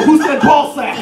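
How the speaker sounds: imitated excitable interjection; eager lieutenant. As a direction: pop in with a high, eager question. Acting out lieutenant jumping out.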